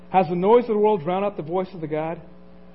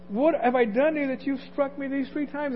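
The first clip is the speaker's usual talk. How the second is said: higher-pitched, lightly voiced donkey; a hint of an indignant donkey, pitched up; donkey talking back; 6 semitones higher than usual. Donkey to Balaam who has struck her, lightly acted and higher. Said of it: "the donkey's going".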